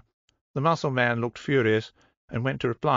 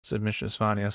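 ordinary delivery, nothing marked

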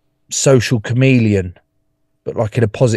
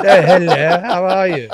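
high, loud, laughing mock greeting; mock self-imitation; 8 semitones higher, 5 dB louder than usual. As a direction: send up your own hello in a high-pitched voice. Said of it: "is that how I came into this room".

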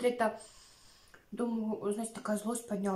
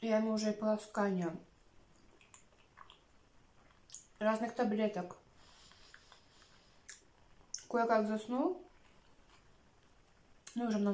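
talking while chewing; chewing food between words; mouth full. Real, not acted. Seen 0: Closed, working mouth and full cheeks while talking between sushi bites.

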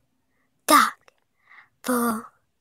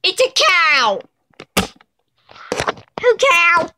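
excited high shout; 8 semitones higher, 7 dB louder, slower than usual. Real, not acted. Excited reveal of toy cow.

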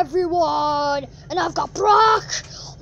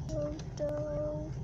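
much softer, fading don'ts; fading plea; 3 semitones lower, 15 dB quieter, much slower than usual. Pretend. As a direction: trail off pleading.

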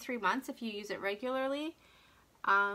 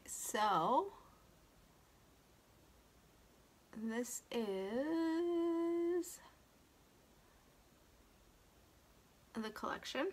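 talking while doing mascara; slow, distracted, mid-application; applying mascara; 3 semitones higher, 4 dB quieter, much slower than usual. Real, not acted. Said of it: "I cannot talk and do my mascara at the same time".

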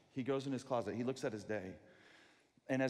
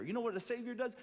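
ordinary talk, nothing marked

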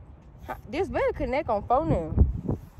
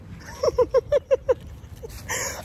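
high, breathy string of laughs; wheezing laugh; 11 semitones higher than usual. Real, not acted. Laughing at picking up trash, wheezy laugh. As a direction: laugh helplessly in quick high puffs.

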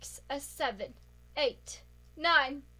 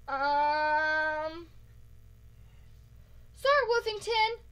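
ordinary delivery

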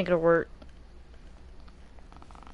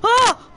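scream; 12 semitones higher, 6 dB louder than usual. Real, not acted. Caught by killer, screaming.

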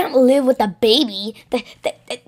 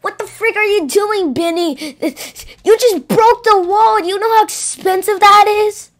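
angry scolding; 7 semitones higher than usual. Acted. Bonnie scolds baby for broken wall.

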